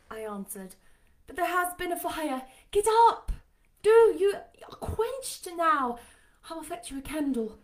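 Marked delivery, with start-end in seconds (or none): 1.3-7.6 high-pitched urging; urgent Jane character voice. A narrator's reading. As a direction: urge him up in a high voice. Jane urges Rochester out of bed.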